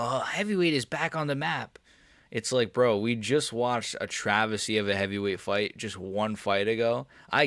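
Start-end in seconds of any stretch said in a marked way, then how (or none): 0.0-1.8 mocking commentators. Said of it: "commentators are glazing heavyweight".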